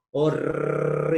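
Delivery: Horr- with a long vibrating rolled R. Shown as a demonstration. Voice teacher shows workshop vibrating on the R, rolled. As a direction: trill the R in Horr- and stay on it. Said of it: "¿Qué pasa si empiezo a vibrar y hago esto?".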